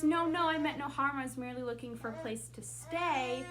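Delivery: high, loud opening, settling as it goes; pleading line reading. Shown as a demonstration. Acting Maurice's plea to Beast.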